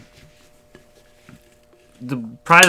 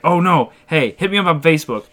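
higher, louder overfriendly stranger; moving-truck guy; 6 semitones higher, 5 dB louder than usual. Retold or imitated. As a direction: imitate a pushy guy handing out his contact. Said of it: "And then he was like".

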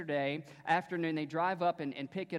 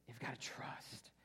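soft breathy pulpit whisper; 4 semitones lower, 14 dB quieter than usual. Acted. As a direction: hush it for emphasis. Preacher drops to a whisper.

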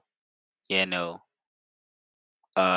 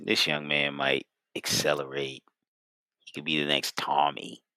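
lovestruck fondness; 6 semitones lower, 7 dB quieter than usual. Real, not acted. Podcast host fondly praising a young quarterback, lovestruck. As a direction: say it softly, like you've got feelings for him. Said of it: "you said it like you got feelings for him".

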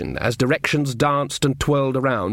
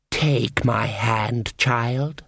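evil queen; much slower than usual. A narrator's reading. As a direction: coax the child in slowly. Queen lures Diamanda.

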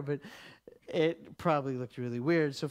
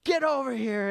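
raised, higher retold summons; calling someone over; 7 semitones higher, 4 dB louder than usual. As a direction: call the cashier over. Calls cashier over for prayer.

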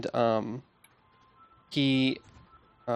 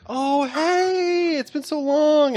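much higher, loud, bright-eyed greeting; playing along; 5 dB louder, much slower than usual. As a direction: fake a joyful reunion in a high voice. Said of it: "she goes".

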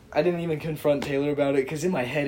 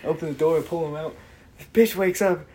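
ordinary talk

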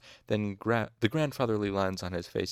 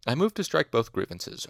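lightly voiced old man, raised pitch; a hint of an elderly master, louder; old physicker; 5 semitones higher, 5 dB louder than usual. A narrator's reading. Old master moves to drop charges, lightly voiced old physicker. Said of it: "the Physiker's old voice".

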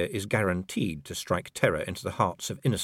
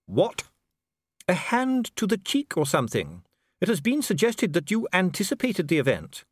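higher, smooth and coolly polite; a bureaucrat soothing a prisoner; calm official Dee; 12 semitones higher, 4 dB louder than usual. A narrator's reading. Dee answering imprisoned Vimes, coolly polite.

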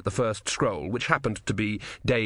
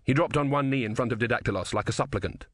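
usual speaking voice

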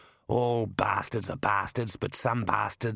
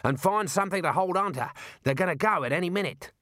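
raised, higher-pitched warning; urgent yell; 6 semitones higher than usual. A narrator's reading. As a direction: call out instructions over the chase. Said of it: "yelled Mad".

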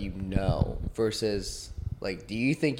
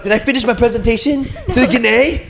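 high, loud, dazed student; 12 semitones higher, 10 dB louder than usual. Pretend. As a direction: blurt it like just waking up. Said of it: "You wake up".

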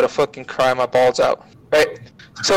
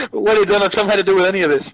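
incredulous laughing disbelief; 6 semitones higher, faster than usual. Retold or imitated. Baffled at Trump cameo.

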